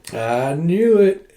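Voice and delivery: slow, drawn-out gloat; knowing glee. Real, not acted. Reader seeing the kiss coming, gloating. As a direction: stretch it out as it climbs.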